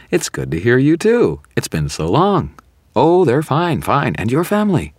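high, swooping, syrupy small talk; saccharine gushing. A narrator's reading. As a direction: gush like a long-lost friend, hammed-up. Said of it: "overflowing with saccharin".

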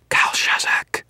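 forceful hissed whisper; voiceless, menacing incantation. A narrator's reading. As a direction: whisper it like a summoning. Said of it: "Victor whispered".

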